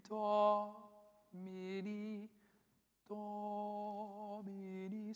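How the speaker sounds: "Domini" sung very slowly and high with a sagging, broken-up line; wrong accented Domini. Shown as a demonstration. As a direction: demonstrate how "Domini" should not sound, stretched and high. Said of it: "we don't wanna hear".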